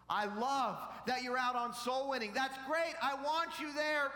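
high, loud, emphatic proclamation; full-force preaching. Acted. Preacher voicing the Heavenly Father to church, full-force preaching. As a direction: preach it as God's praise, loudly.

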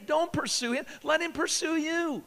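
rising high and pleading; high urging. Acted. Preacher urges girls at camp, high and pleading. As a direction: push the pitch way up to plead.

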